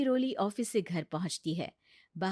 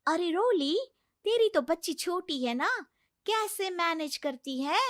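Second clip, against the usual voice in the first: higher, bubbly office friend; chatty colleague; 8 semitones higher than usual. Acted. Office friend asking Roli about her baby, bubbly and higher. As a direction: gossip brightly with a coworker. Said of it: "office में सहेली कहती है".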